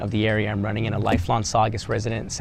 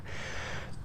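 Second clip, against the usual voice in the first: breathy evil villain chuckle; a sinister low snicker; 15 dB quieter than usual. Pretend. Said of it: "give me your best evil villain laugh".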